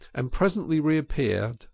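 ordinary delivery, nothing marked

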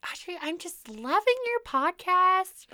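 high, slow mock mom; mom's voice. Daughter imitating mom praising the podcast, high. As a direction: mimic mom's gushing praise.

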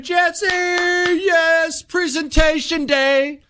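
high, slow cheer; class cheering. Retold or imitated. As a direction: stretch the cheer out.

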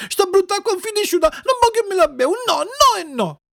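high wailing tantrum; tearful shouting. Acted. Puppet refusing the medicine, bursting into tearful cries. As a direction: wail your refusal like a crying child. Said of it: "gridò Pinuccio scoppiando a piangere".

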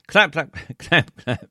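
slow, loud, laughing clap clap; mock applause. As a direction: read the claps out big and slow through a laugh.

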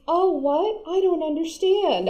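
high-pitched helpless confusion; mocking ditzy bewilderment; mock clueless character. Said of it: "has no idea what's going on".

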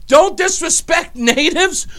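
high, loud disbelief breaking into laughter; retold incredulity. Mocking the liberal's hypocrisy, laughing incredulity. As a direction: list it in high, laughing amazement. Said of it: "I thought my head was going to explode".

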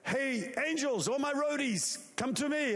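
preacher voicing a commander's call; God rallying helpers. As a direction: call the crew in from afar. God calls his angel roadies.

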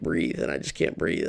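low, softened, running out of air; retold choking plea. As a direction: let the voice sink as if choked. Retelling being choked by dad.

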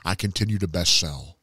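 usual speaking voice

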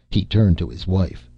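ordinary delivery

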